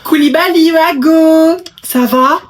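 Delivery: high, loud call across the street; yelling to a friend across the way. Acted. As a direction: shout his name to get his attention. Calling out to a friend.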